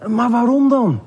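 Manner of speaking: high-pitched, puzzled question; taken aback and wanting to know. Acted. Neus asks why Hand leaves.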